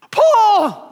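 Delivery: high, loud cry of the name; urgent calling out. Retold or imitated. Believers begging Paul not to go, crying out loudly.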